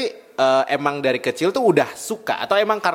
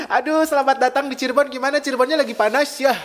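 excited welcome; 10 semitones higher than usual. Real, not acted. Host welcomes guest brightly.

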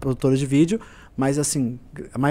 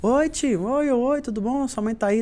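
put-on higher, lightly childlike greeting; little boy voice; 7 semitones higher, slower than usual. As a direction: imitate a small boy at the door, sweet and polite. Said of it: "tinha um menininho desse tamanhozinho".

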